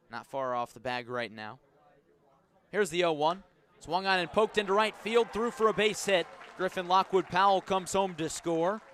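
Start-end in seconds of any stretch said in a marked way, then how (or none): 2.7-8.8 higher and louder, quickening at the end; building play-by-play joy on the go-ahead run; excited scoring call. Real, not acted. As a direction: lift the voice as the run scores. Live call, RBI single scores run.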